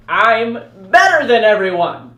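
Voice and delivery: loud, cocky brag; hammed-up arrogance; smug boast. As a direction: brag like a know-it-all kid. Mocking middle-school arrogance.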